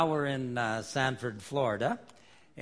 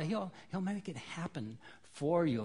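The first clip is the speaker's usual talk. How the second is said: ordinary talk, nothing marked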